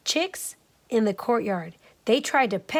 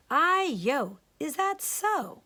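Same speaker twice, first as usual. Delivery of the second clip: much higher, slow astonished granny; higher, slower surprised old woman; 6 semitones higher, slower than usual. Acted. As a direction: marvel like an old lady. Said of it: "said the old woman".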